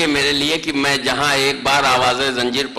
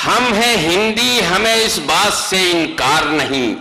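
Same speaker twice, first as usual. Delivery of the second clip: stately nazm recitation; 5 semitones higher, slower than usual. Acted. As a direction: repeat pitched higher with rising emphasis. Repeat of couplet. (بلند آہنگ میں شعر کی تکرار)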